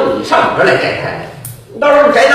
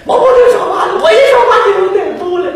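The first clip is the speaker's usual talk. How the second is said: high, fast shouted warning; 10 semitones higher, much faster than usual. Acted. Vendor yelling at the monk not to talk. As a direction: blurt the warning in panic.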